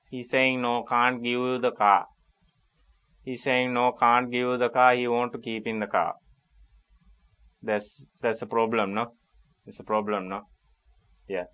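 muttered off the phone; hushed aside. Acted. Agent muffles his voice to confer with a colleague about the customer, hushed. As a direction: drop your voice as if talking to a colleague away from the receiver.

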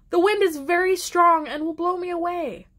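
put-on high-pitched worried child's objection; protesting. A narrator's reading. Flora keeps protesting to mother.

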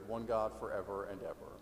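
ordinary talk, nothing marked

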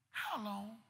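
hushed, drained question to God; acted exhausted prayer; broken plea. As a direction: drop to a soft, defeated murmur. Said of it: "I'm broken to pieces".

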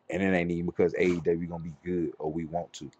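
usual speaking voice

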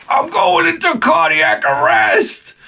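stretched-out high mock scream of panic; mock panic shout. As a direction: overplay a kid sure he's dying. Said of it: "somebody would, in the hallway".

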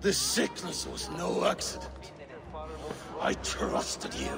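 quiet, bitter betrayal; a low, wounded hiss; hurt and angry. Acted. Cold accusation of betrayal.

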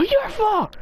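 slow high accusing cry; blaming wail. Real, not acted. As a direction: wail the blame at a teammate. Sudden blaming outburst.

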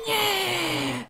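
high gleeful yeah cheer; excited cheer. Real, not acted. Boss kills vampires.

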